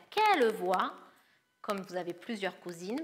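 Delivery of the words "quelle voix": ordinary talk, nothing marked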